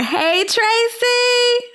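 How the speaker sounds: high stretched-out hey Tracy; excited greeting. Real, not acted. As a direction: call out her name with joy. Greeting the guest loudly.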